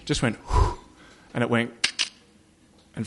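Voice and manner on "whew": imitated long breath out; blown breath. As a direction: blow out a breath, as if onto cold canvas. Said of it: "And I breathed onto the tent".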